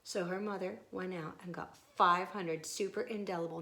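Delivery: plain reading voice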